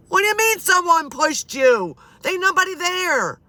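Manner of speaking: high, loud incredulous retort; acted loud disbelief. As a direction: exclaim it at Dad, acting out disbelief. Acting out her disbelieving retort to Dad.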